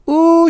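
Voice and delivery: high loud frightened squeal; doll squeal. A narrator's reading. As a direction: squeal while slipping. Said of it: "she squealed".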